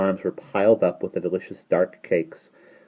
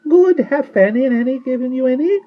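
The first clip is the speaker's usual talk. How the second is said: put-on very high, louder little-old-woman voice; tiny old shopkeeper; 9 dB louder than usual. A narrator's reading. Old shopkeeper boasting to the children, high and chatty.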